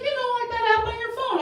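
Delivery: high, quick coworker voice; coworker explaining. Retold or imitated. Coworker explains a payment app to a clueless friend, high and quick. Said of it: "She's like".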